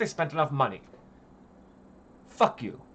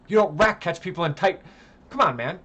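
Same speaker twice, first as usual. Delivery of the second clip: usual speaking voice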